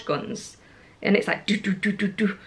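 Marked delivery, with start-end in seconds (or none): none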